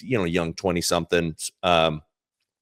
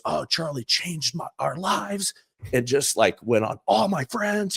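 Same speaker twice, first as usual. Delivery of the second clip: put-on moved young fan; 9 semitones higher than usual. Boyfriend moved, retold.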